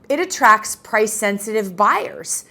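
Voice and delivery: ordinary talk, nothing marked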